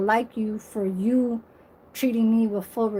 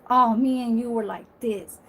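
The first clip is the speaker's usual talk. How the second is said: ordinary talk, nothing marked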